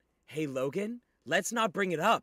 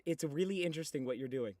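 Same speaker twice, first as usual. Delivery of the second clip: sarcastic; 2 semitones lower, 7 dB quieter, faster than usual. Acted. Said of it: "make me rage".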